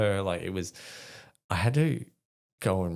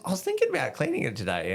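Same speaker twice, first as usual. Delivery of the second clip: higher and fast, a light take on his wife; chirpy, quick higher voice for the wife; wife's voice; 12 semitones higher, much faster than usual. Retold or imitated. Retelling his wife's casual remark in a higher voice.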